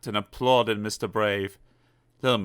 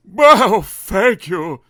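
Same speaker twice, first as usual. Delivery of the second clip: grateful Mister Messy; 9 semitones higher, 10 dB louder, much slower than usual. Acted. Rescued Mister Messy thanks him.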